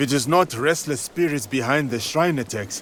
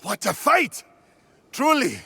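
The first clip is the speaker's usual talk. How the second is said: excited cheer; 13 semitones higher than usual. Acted. Cheering a friend after a duel.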